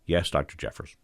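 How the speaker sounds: low, subdued obedient reply; acted cowed trainee; meek submission. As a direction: give in softly to the threatening boss. Trainee submits after being yelled at.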